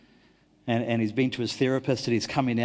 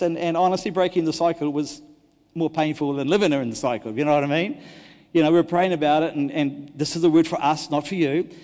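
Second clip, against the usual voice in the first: ordinary delivery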